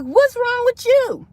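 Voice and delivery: high, drawn-out question; startled concern. Retold or imitated. Ex walks in, sees her crying.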